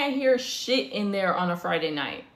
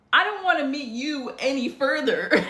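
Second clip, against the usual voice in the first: exasperated retold refusal; 4 semitones higher than usual. Rejecting date's invitation to meet mom.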